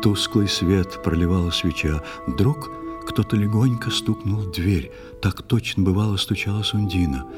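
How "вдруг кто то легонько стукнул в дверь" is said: usual speaking voice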